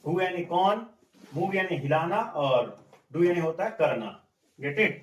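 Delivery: ordinary talk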